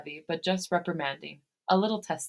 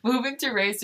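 laughing; 6 semitones higher, 4 dB louder than usual. Real, not acted.

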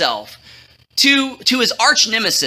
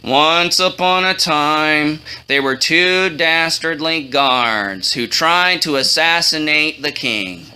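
low, slow storybook drone; historian reading aloud; 6 semitones lower, slower than usual. Acted. Historian reading the chronicle to the king, low and slow. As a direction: intone it low and drawn out.

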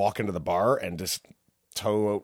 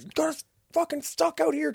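high-pitched mock fury; high, strained complaint in mock anger; retold angry friend. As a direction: imitate a friend griping, voice pitched way up. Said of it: "my friend Scott's so mad".

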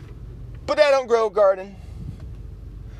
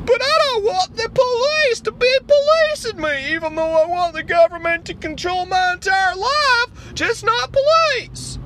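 high, loud gushing activist voice; excited liberal caricature; 12 semitones higher, 3 dB louder than usual. Acted. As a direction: gush about the government, pitched way up. Liberal character again.

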